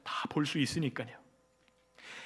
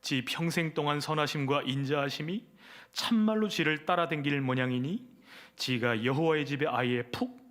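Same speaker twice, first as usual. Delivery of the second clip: Chungcheong dialect; 2 semitones lower than usual. Acted. Pastor reads Psalm 23 parody to congregation in Chungcheong dialect. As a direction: read the psalm like a folksy Chungcheong farmer. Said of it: "충청도 버전".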